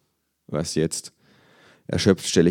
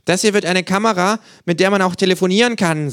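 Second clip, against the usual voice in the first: much higher, louder eager kangaroo; 14 semitones higher, 7 dB louder than usual. Acted. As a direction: sell it like a gadget nerd. Känguru voiced by narrator.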